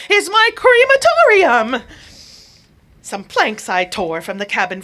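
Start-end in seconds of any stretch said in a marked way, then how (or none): 0.1-1.8 high, loud burst of discovery; a high shout of revelation; sudden cry. Acted. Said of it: "said I with a sudden cry".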